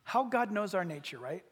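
ordinary delivery

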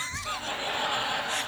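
high, shrieking burst of laughter; real high laughing shriek. Real, not acted. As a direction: let out a high laugh of delight. Laughs at the phone call.

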